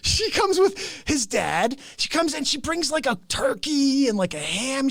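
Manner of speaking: ordinary delivery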